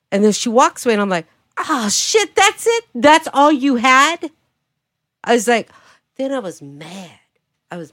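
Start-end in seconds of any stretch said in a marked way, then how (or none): 1.6-4.3 high, incredulous taunt stretching out; mock disbelief taunt. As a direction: act amazed at a feeble comeback. Taunts rival walking away, retold.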